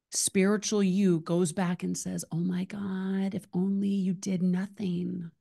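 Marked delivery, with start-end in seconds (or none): none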